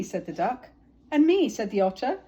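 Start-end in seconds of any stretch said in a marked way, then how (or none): 1.1-1.5 high, loud eager chime. Acted. Otter piping up to be a friend, high and loud. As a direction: pipe up eagerly.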